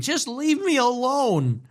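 high, slow, whimpering mockery of a teary plea; mock crying plea. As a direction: mimic a weepy man begging to be left alone. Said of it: "literally on the verge of tears, crying".

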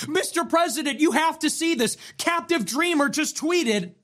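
high, breathless-news style mock urgency; hammed-up alarmed staffer; mock urgent aide. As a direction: burst in with mock-important news. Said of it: "Susie Wiles is gonna kick down the door".

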